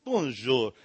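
a slow, quiet mock French greeting; mock French accent. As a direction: purr it like a cartoon Frenchman. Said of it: "people that talk like".

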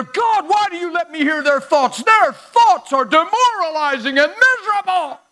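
loud, soaring preacher's cry; full-throated pulpit preaching; preaching at full force. Real, not acted. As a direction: preach it out to the whole room, building.

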